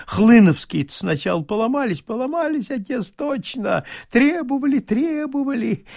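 high, loud gushing tipsy praise; gushing singsong. A narrator's reading. Tipsy bailiff gushes praise at his master. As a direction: sing out your drunken devotion. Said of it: "bailiff reports to master".

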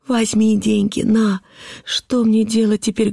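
put-on high, distressed child's plea; horrified cry. A narrator's reading. Girl in horror gives father the money, crying out. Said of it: "закричала я в полном ужасе".

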